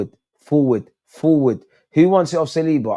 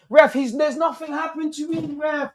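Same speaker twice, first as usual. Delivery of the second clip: angry protest at ref; 13 semitones higher than usual. Real, not acted. Protesting referee decision.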